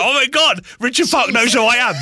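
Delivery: high, giddy, laughing disbelief; starstruck excitement. Retold or imitated. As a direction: gush like a fan who just got noticed. Starstruck by the boss knowing him.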